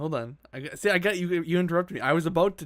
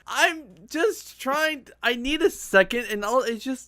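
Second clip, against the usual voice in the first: high, strained tearful plea; about to cry; 6 semitones higher than usual. Real, not acted. Nervous host asks co-hosts for a second, about to cry. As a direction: plead haltingly on the verge of tears. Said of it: "sounds like he's about to cry".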